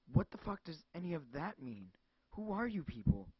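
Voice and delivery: loud, high, panicked yelling; horrified scream. Acted. Cassandra screaming at the caller, horrified. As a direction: scream it in horror. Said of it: "letting out horrified screams".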